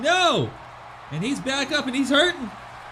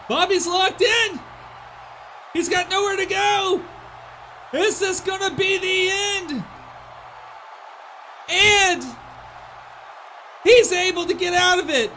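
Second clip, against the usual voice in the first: excited shout; 7 semitones higher than usual. Real, not acted. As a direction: build the tension, then shout. Submission near-finish in commentary.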